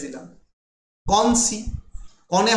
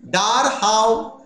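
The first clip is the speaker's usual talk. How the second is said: ডার হাউ said very slowly, each syllable stressed in turn; slow stressed scansion of ডার হাউ; scanning the verse; 3 semitones higher, much slower than usual. Shown as a demonstration. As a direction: spell out the iambic beat on ডার হাউ. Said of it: "আরেকটা line করে নিচ্ছি".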